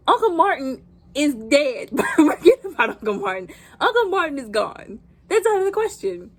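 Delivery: loud, high, emphatic verdict with a laugh; amused, exaggerated insistence; blunt dismissal. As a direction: shut the idea down emphatically. Bluntly dismissing the uncle's rescue.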